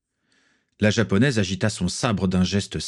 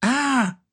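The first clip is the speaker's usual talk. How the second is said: high yelp of pain; pained cry. A narrator's reading. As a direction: cry out high as the arm is twisted. Boy's arm is twisted behind him.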